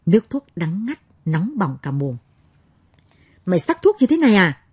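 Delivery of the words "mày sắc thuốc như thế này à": high, loud scolding shout; furious mistress. A narrator's reading. Mistress yelling at the servant over bitter medicine, enraged. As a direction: snap at the servant in a rage. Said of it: "Bà thêm điên tiết".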